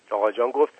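ordinary delivery, nothing marked

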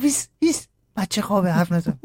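high-pitched urgent hush, imitated; wife shushing husband. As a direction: shush him in a put-on high voice. Wife hushing returning father.

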